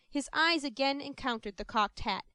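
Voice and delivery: plain reading voice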